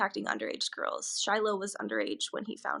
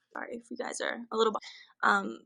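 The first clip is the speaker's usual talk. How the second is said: ordinary talk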